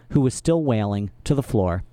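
plain reading voice

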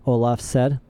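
ordinary delivery, nothing marked

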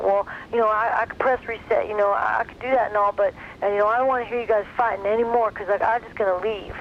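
lightly voiced Texas teen. Voicing the teen son again.

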